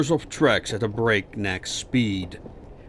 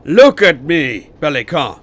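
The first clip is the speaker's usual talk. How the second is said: resounding shout; 4 semitones higher, 13 dB louder than usual. A narrator's reading. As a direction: make it ring across the carriage. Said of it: "his voice resounded across the carriage".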